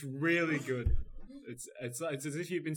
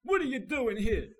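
hammed-up rock star yell; 10 semitones higher, 9 dB louder than usual. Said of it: "It's me, [name]".

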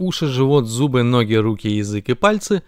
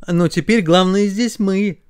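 slow, raised, solemn announcement; solemn proclamation; 6 semitones higher than usual. Acted. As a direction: proclaim yourself in charge. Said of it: "торжественно произнесли ноги". (торжественно, нараспев)

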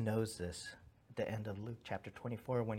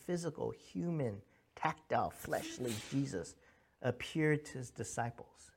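ordinary delivery

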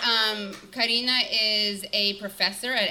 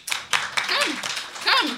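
coaxing call; 11 semitones higher than usual. Real, not acted. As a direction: beckon the shy guest up, sing-song. Host calling shy guest onstage.

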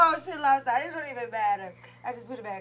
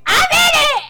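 much higher, louder shout; excited shout; 12 dB louder, slower than usual. Real, not acted. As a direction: yell it out proudly. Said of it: "excited announcement, peak".

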